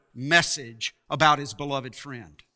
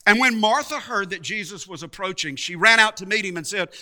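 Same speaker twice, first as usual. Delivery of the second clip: ordinary talk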